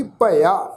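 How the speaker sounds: careful model reading of ਭਇਆ as bha-i-aa; demonstrated pronunciation. Shown as a demonstration. Teacher shows congregation how to read ਭਇਆ, careful pronunciation. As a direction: say ਭਇਆ carefully with a drawn final aa. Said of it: "ਤੋ ਉਚਾਰਨ ਕਰਾਂਗੇ ਜੀ".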